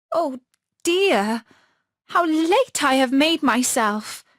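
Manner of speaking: fretting at lost time; little girl's dismay. Acted. Gerda fretting at how late it has become. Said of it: "said Gerda".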